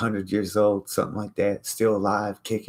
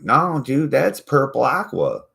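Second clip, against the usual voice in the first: higher, louder insistent whine; mock friend insisting; 8 semitones higher, 6 dB louder than usual. Friends insisting to him, higher insistent whine. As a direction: whine it back stubbornly. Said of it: "They're like".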